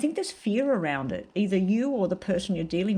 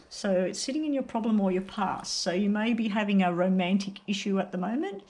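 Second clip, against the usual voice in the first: ordinary talk, nothing marked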